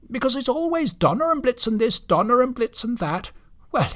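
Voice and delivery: high-pitched indignant reindeer, quick at first; high, huffy character complaint; Blitzen. Acted. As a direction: protest like an aggrieved stickler.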